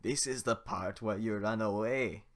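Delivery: loud, slow ogre menace; slow, teasing ogre threat; Shrek voice. Acted. Shrek tells the villagers to run away, slow and loud.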